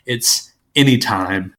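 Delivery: slowly drawn-out Anytime; drawn-out name. Shown as a demonstration. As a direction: stretch the word Anytime long and slow. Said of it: "the kind of colloquial term for".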